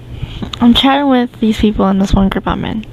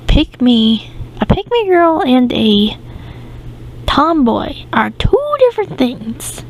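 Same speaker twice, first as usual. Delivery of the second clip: confused, higher-pitched protest; baffled; 4 semitones higher than usual. Retold or imitated. Speaker answers a guy lumping pick-me girls with tomboys, baffled. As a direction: say it as if utterly puzzled by the mix-up. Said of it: "I looked at him so confusedly".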